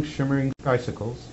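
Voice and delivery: ordinary delivery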